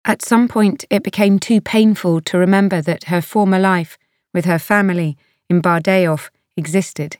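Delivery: usual speaking voice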